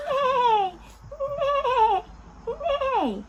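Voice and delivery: high, loud whinny falling away; horse neigh. Shown as a demonstration. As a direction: neigh like a horse for little kids. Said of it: "A horse says".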